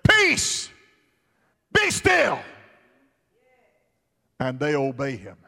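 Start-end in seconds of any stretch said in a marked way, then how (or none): 0.1-2.4 high, loud command to the storm; Jesus shouting down the wind. Acted. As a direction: rebuke the storm with full force. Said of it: "he simply says".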